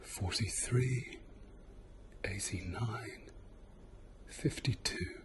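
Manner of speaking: slow whispered numbers in the ear. Real, not acted. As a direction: breathe the numbers softly, close in. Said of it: "Same thing on this side".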